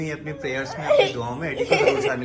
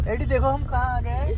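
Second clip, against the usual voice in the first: retold baby talk; 8 semitones higher than usual. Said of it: "जैसे बोला मैं तो".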